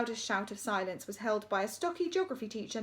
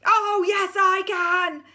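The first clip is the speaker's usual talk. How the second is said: loud panto retort; deputy head thundering at the school; 10 semitones higher, 12 dB louder, much slower than usual. Acted. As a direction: thunder it back defiantly. Panto exchange.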